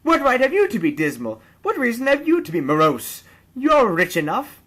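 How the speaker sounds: high, loud playful retort; cheerful teasing. A narrator's reading. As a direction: throw his words back at him merrily. Nephew mirrors Scrooge cheerfully.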